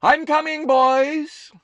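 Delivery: very high, loud happy call; gleeful shout. Acted. Mother calling to diner customers, gleeful shout. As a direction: shout back to the customers with glee. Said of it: "gleefully shouting".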